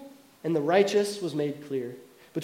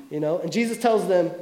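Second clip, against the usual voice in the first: usual speaking voice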